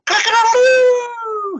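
loud, high rooster crow. Acted. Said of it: "he cries".

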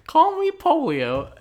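high and slow, drawn-out put-on kid voice; slow silly kid voice. Introducing the made-up kid Polio, high and slow. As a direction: stretch it out slowly in a squeaky kid voice.

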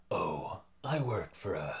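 as low as possible. Shown as a demonstration. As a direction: drop your register right down for a hammy villain. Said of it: "as low as I could go".

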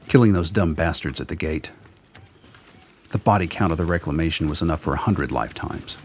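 ordinary delivery

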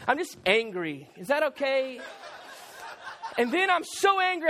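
normal talking voice

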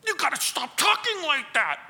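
high, strained mock outburst; angry trembling objector. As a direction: act someone too upset to let it go on. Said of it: "they're so angry with you".

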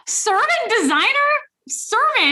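high-pitched disbelieving question; incredulous. Real, not acted. Co-host reacting to the message's job title, incredulous.

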